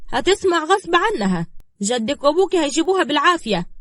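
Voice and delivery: high, loud insistence; domineering woman. Acted. Woman threatening girl with forced return, domineering. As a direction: overrule her forcefully.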